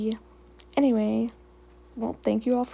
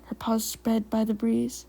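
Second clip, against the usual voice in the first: usual speaking voice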